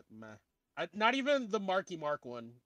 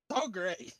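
laughing; 12 semitones higher, 5 dB louder than usual. Real, not acted. Laughing at the memes.

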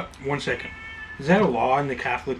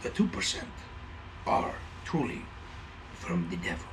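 ominous whisper; 6 semitones lower, 8 dB quieter than usual. Retold or imitated. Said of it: "He's like".

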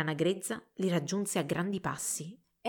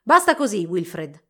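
angry; 9 dB louder than usual. A narrator's reading. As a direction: cut him off angrily. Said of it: "disse Albert arrabbiato".

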